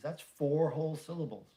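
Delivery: ordinary talk, nothing marked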